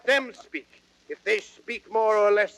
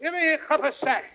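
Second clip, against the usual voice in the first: angry demand; 7 semitones higher, faster than usual. Acted. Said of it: "puffing and blowing with rage".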